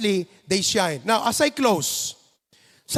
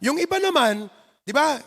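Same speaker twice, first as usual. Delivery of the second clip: ordinary delivery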